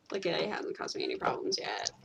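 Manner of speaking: unvoiced whispered aside. Real, not acted. Friend confides about copying accents, in a whisper. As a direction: drop to a whisper of relief.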